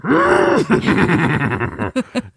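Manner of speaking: high sputter like a car starting; whinny like a horse crossed with an engine; horse-car engine noise. Retold or imitated. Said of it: "a cross between a horse and a car".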